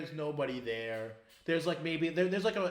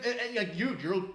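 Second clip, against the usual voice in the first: ordinary talk, nothing marked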